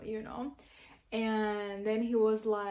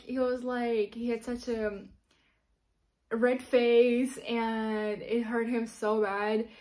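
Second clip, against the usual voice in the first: ordinary delivery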